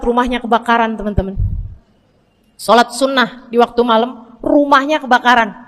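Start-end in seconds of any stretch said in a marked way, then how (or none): none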